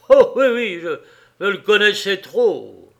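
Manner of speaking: accused man flaring up when the dead man is named; bitter outburst. Acted. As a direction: let the old grudge burst out. Accused bursts out at judge.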